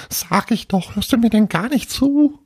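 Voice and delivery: high-pitched, indignant ghost voice; impatient little ghost. Acted. Little ghost scolds Paul for not listening, high indignant voice.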